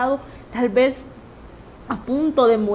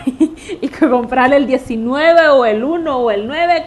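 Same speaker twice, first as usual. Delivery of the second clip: slow, louder words through laughter; laughing; 7 dB louder than usual. Real, not acted. Laughing as she tells of the lottery numbers, louder. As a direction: laugh while telling the lottery tip.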